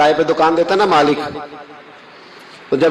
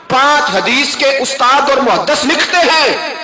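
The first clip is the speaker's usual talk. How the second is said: raised, slow, counting names one by one; fiery preaching; 7 semitones higher, slower than usual. Acted. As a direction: build the list with rising force. Preacher counts scholars emphatically.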